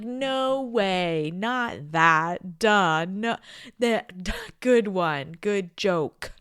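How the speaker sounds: loud, scoffing brush-off; playfully dismissing the universe's hints; mock exasperated retort. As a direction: wave the universe away, half-laughing. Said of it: "I'm like".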